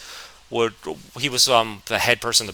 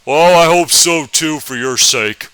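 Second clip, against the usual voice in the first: slow, louder Southern drawl; Southern teacher voice; 3 semitones higher, 7 dB louder, slower than usual. Retold or imitated. History teacher greeting northern kid, slow Southern drawl. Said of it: "That's actually how he sounded".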